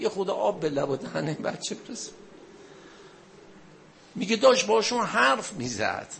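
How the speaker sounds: high, loud mourning sermon climax; a preacher pushing the grieving crowd toward tears; impassioned preaching. Acted. Sermon rising to a lament climax.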